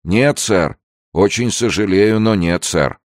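high, emphatic sailor's refusal; refusing sailor. A narrator's reading. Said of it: "отвечал чернокожий матрос". (взволнованный отказ матроса)

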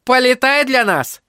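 high-pitched call from the crowd; woman's heckling call; shouted request. A narrator's reading. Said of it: "выкрикнула одна".

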